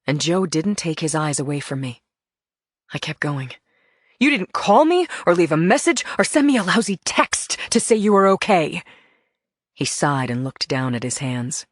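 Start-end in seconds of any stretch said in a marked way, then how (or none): 4.2-8.8 loud, high accusation; angry reproach. Acted. Wife scolding husband for not calling, loud and angry. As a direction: let him have it.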